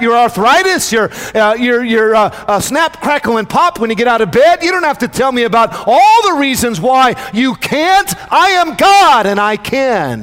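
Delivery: loud, high preaching voice of God; God answers Moses at full force; God speaking. Acted. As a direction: proclaim it as God, rising to a cry. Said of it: "He says".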